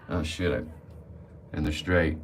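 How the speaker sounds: ordinary talk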